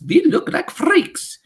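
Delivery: high, slow, loud wail; mock shout. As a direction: witch mimicking a horrified child. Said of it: "Another shouts".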